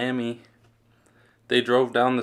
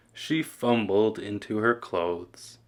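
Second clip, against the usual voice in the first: plain reading voice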